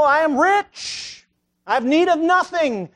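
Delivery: high, loud and very slow, puffed-up and self-satisfied; mock the proud man who thinks he needs nothing; smug rich believer. Preacher mocking complacent Christians, high, loud and slow.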